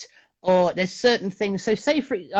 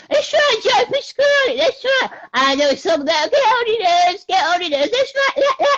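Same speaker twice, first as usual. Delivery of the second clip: much higher squeaky Pasquale voice, louder; Joe Pasquale impression; 11 semitones higher, 5 dB louder than usual.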